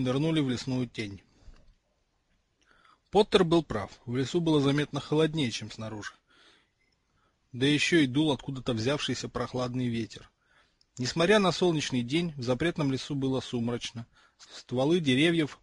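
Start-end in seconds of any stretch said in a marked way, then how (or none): none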